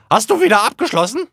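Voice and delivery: high, loud question through the door; mother calling through a locked door. Acted. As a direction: shout the question from outside. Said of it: "rief meine Mutter".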